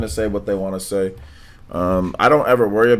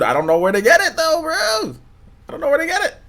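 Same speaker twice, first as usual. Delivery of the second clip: high-pitched laughing wail; mock whine; 4 dB louder than usual. Streamer can't find suya to celebrate, high mock whine. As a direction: whine it way up high in hammed-up despair.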